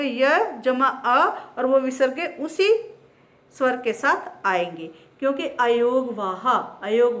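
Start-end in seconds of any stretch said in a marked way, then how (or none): 0.0-1.4 य जमा अ said very slowly, part by part; very slow spelling. Shown as a demonstration. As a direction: drag out य जमा अ for the class. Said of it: "जब य का वर्ण विच्छेद करोगे". (य जमा अ को बहुत धीरे बोलना)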